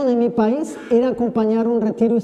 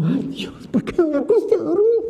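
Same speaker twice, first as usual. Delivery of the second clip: exasperated groan; 7 semitones higher than usual. Retold or imitated. Weary priest regrets staying up.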